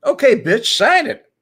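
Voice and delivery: loud, high laughing command; hammed-up demand; mock order. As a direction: bark it like a fed-up board member. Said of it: "the authority to say".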